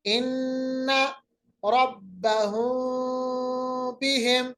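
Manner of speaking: slow recitation, meem in बिहिम joined lightly at the lips; barely touch the lips on the meem of रब्बहुम बिहिम. Shown as a demonstration. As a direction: ikhfa shafawi demo. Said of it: "देखिए कैसे मैंने अदा किया".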